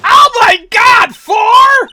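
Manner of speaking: very high, loud scream; stunned, slow full-volume shout; shocked outburst. Real, not acted. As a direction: shriek in disbelief, drawn out. Player shocked by four locks.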